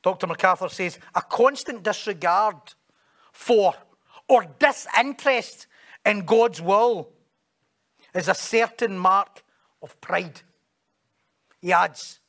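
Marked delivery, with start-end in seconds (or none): 1.2-10.4 slow, loud, weighty preaching; forceful, measured pulpit reading; emphatic quote reading. Real, not acted. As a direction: declaim each phrase with pauses. Reads a quotation emphatically.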